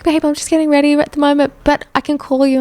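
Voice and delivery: lightly voiced sweet friend.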